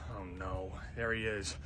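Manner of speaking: low, very soft aside; quiet dread. Acted. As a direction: say it low to yourself, dreading him. Dreads seeing the new teammate.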